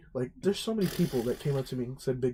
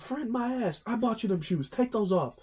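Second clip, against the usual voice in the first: mock scolding girlfriend; 8 semitones higher than usual.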